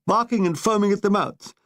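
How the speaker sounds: usual speaking voice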